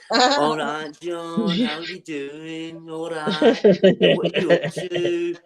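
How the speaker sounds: slow, drawn-out mock Birmingham greeting; Birmingham accent. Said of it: "let's see the accent".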